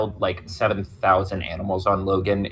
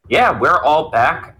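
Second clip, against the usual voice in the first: drawn-out announcement; 6 semitones higher, 6 dB louder, slower than usual. Real, not acted. Announcing the show's return.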